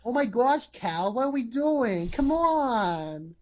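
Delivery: high, drawn-out mock-exasperated Rose; Margot Robbie. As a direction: whine in a put-on high female voice.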